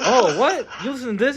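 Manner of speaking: high, loud mock surprise; surprised. Retelling walking in on the playlist, mock surprised. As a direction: act shocked hearing old hip-hop. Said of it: "I'm like".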